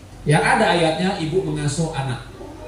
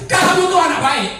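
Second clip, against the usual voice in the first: high-pitched mother's holler; demonstrated yell; 10 semitones higher than usual. Shown as a demonstration. Mother yelling praise at naughty child, high demonstration yell. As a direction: yell it up high as a mock parent. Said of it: "Itu boleh, teriak boleh".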